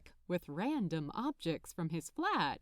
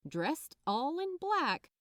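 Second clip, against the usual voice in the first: usual speaking voice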